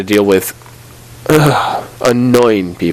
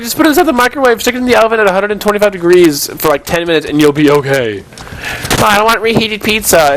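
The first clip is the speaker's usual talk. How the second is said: mocking high voice; 7 semitones higher than usual. Mocking customer, high pitch.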